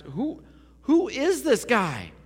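high, loud, drawn-out disbelieving question; terrified astonishment. Acted. Disciples terrified of Jesus after the storm, alarmed astonishment. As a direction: ask it in acted alarm, pitch leaping up. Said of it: "His disciples were terrified of him".

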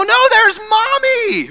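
put-on very high, slow little kid; child voice. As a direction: pipe up like a small child. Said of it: "And you go".

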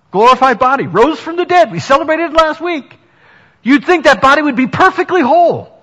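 loud, high, ringing preacher's call; preaching at full force. Acted. Pastor to the congregation, loud and high. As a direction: lift the room with a joyful proclaim.

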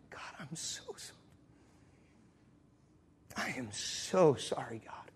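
hushed, slowing apology; repentant whisper. Retold or imitated. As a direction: whisper it, then draw out the apology softly. Repentant prayer.

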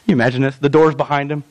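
ordinary delivery

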